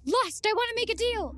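high-pitched calling out at ordinary volume; pretend yell. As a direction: call into the fog to summon a demon. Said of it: "I walk off and I yell".

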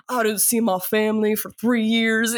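lightly put-on [name] southern accent; a hint of the [name] accent, mocking a method actor; mock [name] drawl. Host mocks Austin Butler's lingering [name] accent. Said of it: "Sorry, with the accent".

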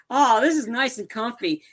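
high, lightly acted sigh of comfort; mock contentment. Old man on the inflatable, high mock contentment. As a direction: play at settling in cosily. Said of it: "Knoxville's sitting there".

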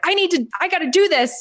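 retelling a frustrated plea. Retold or imitated. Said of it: "I'm all frustrated and I'm like".

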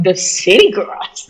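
slow, drawn-out disbelieving question; mock-offended, lingering repeat; incredulous echo. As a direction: throw it back, stretched out in disbelief. Echoes remark back in disbelief.

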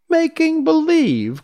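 very high, loud, incredulous; slow shrieked question of disbelief; crying out. A narrator's reading. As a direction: echo it back in high-pitched astonishment. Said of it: "cried Dorothy".

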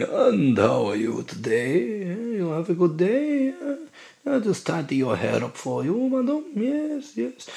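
soft, high, intimate hairdresser patter; ASMR hairdresser. Acted. Hairdresser to customer while snipping hair, soft high ASMR voice. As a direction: croon close to the ear, pitch floating upward. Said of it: "someone's obviously snipping the hair around the dummy and going".

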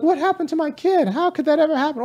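high-pitched mock dismay; mock bewildered parent. Voices hypocrite parents' bewildered reaction.

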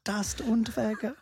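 put-on high German-accented name; mock German accent. Host capping the joke with killer's name, mock German accent.

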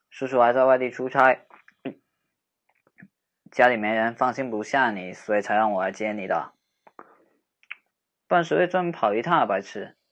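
put-on playful bantering young man walking her home; teasing boyfriend-type friend. Teasing friend walking upset girl home, playful banter. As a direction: tease her, then soften and comfort.

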